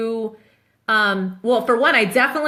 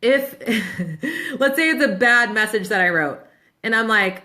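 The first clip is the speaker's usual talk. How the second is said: ordinary delivery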